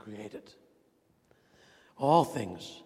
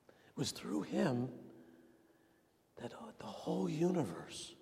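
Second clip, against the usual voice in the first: awed whisper; 3 semitones higher than usual. Real, not acted. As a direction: breathe it out in awe. Awe at creation.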